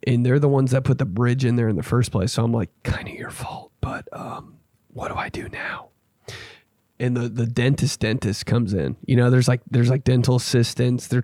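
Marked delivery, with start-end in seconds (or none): 2.8-5.9 barely audible, hesitant mumbled whisper; hushed, sheepish aside; timid whisper. Retold or imitated. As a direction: whisper it as if afraid to blame them. Timid retold reply to dentists.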